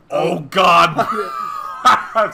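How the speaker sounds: a loud shocked cry breaking into a shouted laugh. Real, not acted. Cohost reacting to a shocking comic panel, laughing shout. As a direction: blurt it out loud, then burst out laughing.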